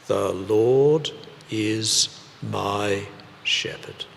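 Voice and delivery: slow, deliberate recitation for the congregation to follow. Shown as a demonstration. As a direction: lead the people in reciting, slowly. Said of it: "Would you like to do it with me".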